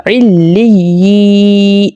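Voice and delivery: علی with y, never j, drawn out long; correct doubled ya. Shown as a demonstration. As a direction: slowly lengthen the yee ending of علی. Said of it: "یہ ہے actual pronunciation".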